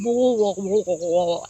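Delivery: low, garbled mouth noise imitating failed speech; garbled mumbo-jumbo. Man's insult fails as his mouth jams, garbled. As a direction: imitate words melting into low mush.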